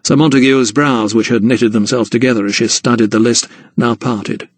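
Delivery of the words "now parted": plain reading voice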